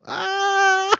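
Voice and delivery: gasp. Real, not acted. Mock gasp at the confession.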